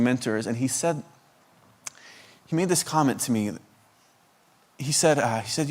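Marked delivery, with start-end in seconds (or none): none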